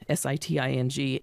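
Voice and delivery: S I T I N G, each letter spoken separately and evenly; spelled out letter by letter. Shown as a demonstration. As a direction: spell S I T I N G out one letter at a time.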